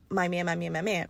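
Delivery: hushed, dreamy chant of my man; soft mooning girlfriend parody; mock lovestruck girl. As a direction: murmur it like she can't think of anything else. Mimicking girls fixated on their man.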